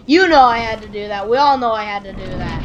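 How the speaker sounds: loud, insistent protest; emphatic self-justification. Pretend. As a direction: insist on it loudly to the audience. Loudly justifying action to viewers.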